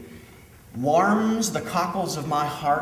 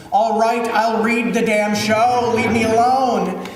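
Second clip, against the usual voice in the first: loud, high, slow exasperated yell; mock scream; 5 semitones higher, 5 dB louder, slower than usual. Director giving in to a haunting show, exasperated mock scream at the heavens. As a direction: yell it up at the sky, dragging the words out. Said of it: "scream at the heavens".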